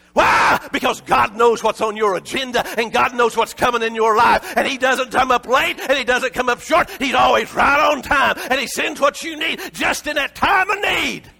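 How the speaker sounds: shouted, high preaching cry; full-force sermon shout; fiery preaching. Acted. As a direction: preach it to the rafters. Preaching climax.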